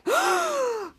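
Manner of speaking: long, high mock gasp; acted-out gasp of astonishment; shocked gasp. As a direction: gasp dramatically, drawn out. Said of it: "Chris James were like".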